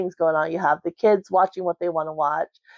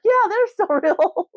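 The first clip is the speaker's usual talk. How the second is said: high, mock-sincere with a laugh breaking through; sarcastic laughing; 13 semitones higher than usual. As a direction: fake a straight answer but crack up mid-line. Mom's sarcastic answer.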